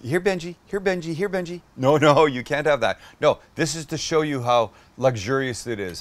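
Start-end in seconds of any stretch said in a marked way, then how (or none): 0.0-3.7 higher, sing-song mock call to a pet; teasing a dog. Teasing a dog with food he cannot have, mock sing-song calling. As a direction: dangle a treat and coo the dog's name. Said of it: "like being mean to a dog".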